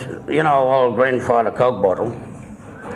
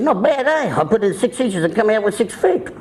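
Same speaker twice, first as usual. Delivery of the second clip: pitched up, chuffed with himself; high joking cousin voice; 9 semitones higher than usual. Retold or imitated. Cousin bragging to the teller, high and chuffed. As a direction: deliver the punchline pleased and high.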